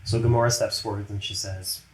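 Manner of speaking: normal talking voice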